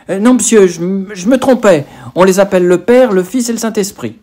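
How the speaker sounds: put-on a nervous boy correcting himself; flustered boy quickly correcting his wrong answer; flustered schoolboy. A narrator's reading. Child answering the vicar.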